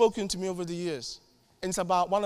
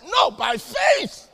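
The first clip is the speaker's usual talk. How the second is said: a sudden shout of faith; 10 semitones higher, 6 dB louder, much slower than usual. Acted. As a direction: shout it out in triumph. Preacher declares victory by faith.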